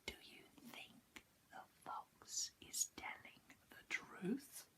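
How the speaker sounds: hushed, conspiratorial whisper; whispering to the child; whispered question to children. A narrator's reading. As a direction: lean in and whisper to the little ones.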